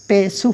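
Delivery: "পেশু" sounded pe-soo, ending in "oo"; pesh vowel demo. Shown as a demonstration. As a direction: try "পেশু" with a u vowel after the s. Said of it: "এটা একরকম".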